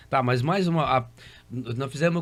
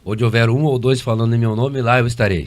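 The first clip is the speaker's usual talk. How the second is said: louder, preached verse; scripture quote; 4 semitones lower, 7 dB louder than usual. Acted. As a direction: declaim it like a preacher. (citação bíblica proclamada)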